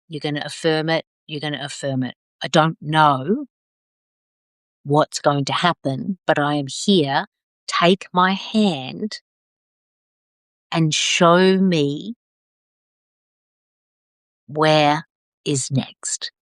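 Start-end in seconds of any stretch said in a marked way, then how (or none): 1.3-2.2 softer echo of "You're gonna affirm it." fading down. Shown as a demonstration. Coach repeating the affirmation instruction, softer fading echo. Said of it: "So every day you're gonna affirm it". 2.4-16.3 slow, open-hearted prayerful lines; slow affirmation. Shown as a demonstration. Coach modelling the daily affirmation, slow and prayerful.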